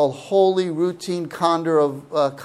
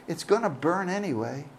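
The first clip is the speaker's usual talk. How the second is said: resigned aside; 7 dB quieter, faster than usual. Retold or imitated. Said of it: "and I say".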